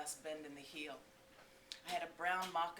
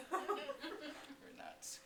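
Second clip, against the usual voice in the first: laughing; 10 semitones higher than usual. Real, not acted. Laughing at the memory.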